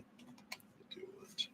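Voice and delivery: very soft, slow whisper; whispered to self. Real, not acted. Reader picking cards for a client, whispering to herself. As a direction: whisper it absently while choosing.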